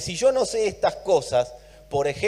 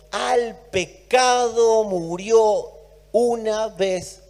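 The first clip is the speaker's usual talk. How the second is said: very slow, higher, each word weighted; slow emphatic; 4 semitones higher, 3 dB louder, much slower than usual. Real, not acted. Preacher stressing Christ died once for all, slow. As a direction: drive the verse home slowly.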